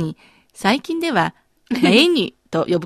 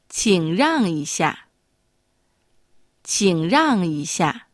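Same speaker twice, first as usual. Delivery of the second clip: slow polite model; much slower than usual. Shown as a demonstration. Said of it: "丁寧に言うこともできます".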